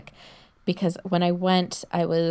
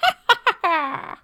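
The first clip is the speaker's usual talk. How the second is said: high cackling witch laugh; 12 semitones higher, 4 dB louder than usual. Acted. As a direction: cackle like an old hag. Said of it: "That's my witch laugh".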